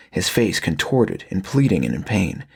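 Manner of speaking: usual speaking voice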